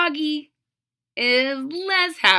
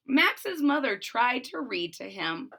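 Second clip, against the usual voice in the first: ordinary delivery, nothing marked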